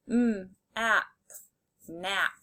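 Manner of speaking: "M, ap" as separate sounds, then "nap" whole; sounded out, blended. Shown as a demonstration. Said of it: "say the sounds and then blend".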